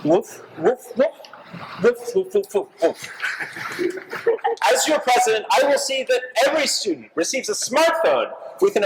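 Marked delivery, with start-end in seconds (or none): 0.0-3.1 lightly voiced dog barks, woof after woof; dog barking. Snoopy candidate barking his campaign speech, lightly voiced dog. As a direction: bark like a dog giving a speech. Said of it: "delivering his speech in Portuguese".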